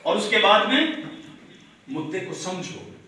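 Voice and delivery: ordinary talk, nothing marked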